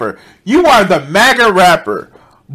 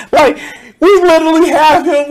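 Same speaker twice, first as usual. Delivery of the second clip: high laugh breaking through the words; laughing disbelief; 9 semitones higher than usual. Real, not acted. Streamer cracking up at the guest's bravado. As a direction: squeal it between laughs.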